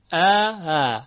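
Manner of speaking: ا struck loud and firm, then ا eased in softly; first ا full glottal, second ا loose; hard then soft hamza. Shown as a demonstration. Teacher demonstrates both hamzas, hard then softened.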